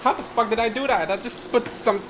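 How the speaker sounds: startled, loud and high; alarmed disbelief. Real, not acted. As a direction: blurt it out in alarm. Smells burning in the kitchen.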